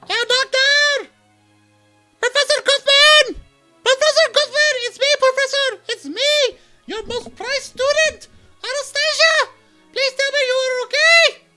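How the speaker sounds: high frantic shouting at a locked door; desperate yell. Acted. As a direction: scream for the professor in panic. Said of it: "To yell that".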